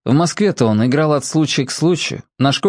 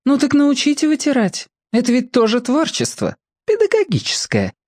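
put-on teasing girl; 13 semitones higher than usual. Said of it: "не удержалась от шпильки".

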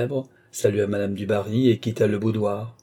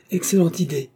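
high-pitched delighted exclamation; enthusiastic; 8 semitones higher than usual. A narrator's reading. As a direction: cry out with sudden enthusiasm. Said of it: "s'écria madame de Béarn dans l'enthousiasme".

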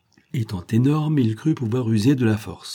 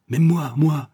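higher-pitched, protesting cry; 5 semitones higher, 4 dB louder, slower than usual. A narrator's reading. Von Kopfen objecting to his arrest, crying out. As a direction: cry out in indignant appeal. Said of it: "s'écria Van Kopfen".

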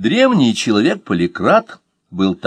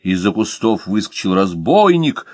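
usual speaking voice

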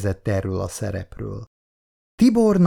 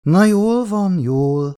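a quick, high placating backpedal; apologetic; 8 semitones higher, 5 dB louder, slower than usual. A narrator's reading. Szabóné backing down to irritated Icu, apologetic. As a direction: hurry to smooth it over with her daughter. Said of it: "mentegetőzött Szabóné".

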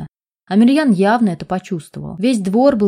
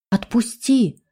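scared cry, strained voice; frightened scream; 3 semitones higher, much slower than usual. Acted. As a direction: cry out in fear. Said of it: "испуганно закричала Рита".

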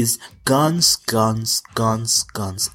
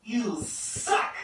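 mock heckler; 12 semitones higher, 9 dB quieter than usual. Mock heckle after song.

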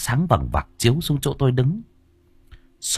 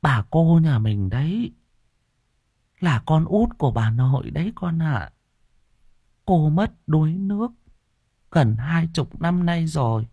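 sighing grandmother; 7 semitones higher than usual. Acted. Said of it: "Bà nội mới thở dài".

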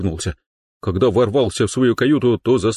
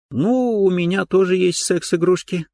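high bright smiling voice; smiling girl voice; 11 semitones higher than usual. Acted. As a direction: say it with a grin, high. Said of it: "расплылась в улыбке".

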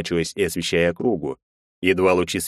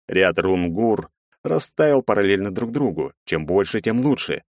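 ordinary delivery, nothing marked

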